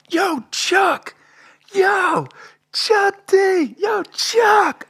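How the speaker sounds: very high, loud squealed shouts; yelling out. Retold or imitated. Kid calling to rapper through the tarp, excited high yelling. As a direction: holler repeatedly like a starstruck kid. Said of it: "I'm yelling out to him".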